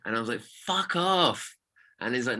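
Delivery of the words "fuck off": high, drawn-out incredulous retort; stunned, pitched well up; acted disbelief. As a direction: refuse to believe the news. Retelling his repeated disbelief.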